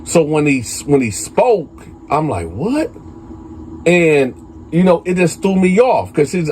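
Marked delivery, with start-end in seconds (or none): 2.5-2.9 quiet, high-pitched startled "what"; mock surprise. Storyteller replaying own reaction to K B speaking up, mock surprise.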